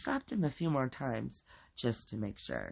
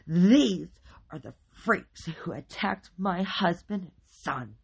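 raised, emphatic, venom-filled accusation; venomous; 6 semitones higher, 8 dB louder, slower than usual. A narrator's reading. Wife accuses the neighbors before police, venomous. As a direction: spit it out with venom. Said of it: "she said with venom in her voice".

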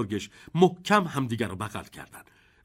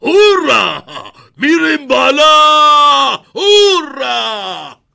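high, very loud drawn-out cheers; exultant shout; 11 semitones higher, 13 dB louder, much slower than usual. A narrator's reading. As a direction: whoop with joy, stretching the last vowels. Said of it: "بابا بزرگ فریاد زد".

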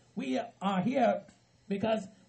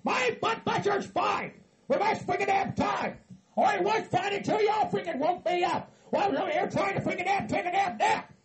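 high-pitched, indignant yell; angry retort; 8 semitones higher than usual. Acted. Angry retort when told calm down.

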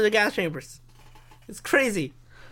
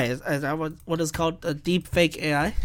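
normal talking voice

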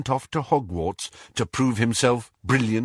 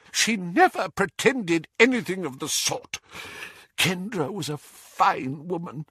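higher, faltering protest, softening at the end; miserable; 9 semitones higher than usual. A narrator's reading. Doge defending Kendra to Muriel, miserably. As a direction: protest miserably, losing force. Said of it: "whispered Doge miserably".

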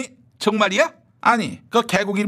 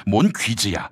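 low stern minister; 11 semitones lower than usual. Acted. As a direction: press him like a cold interrogator. Low rival character.

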